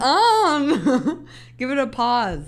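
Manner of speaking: high laughing hold on. Real, not acted. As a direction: break up laughing. Cracking up during retakes.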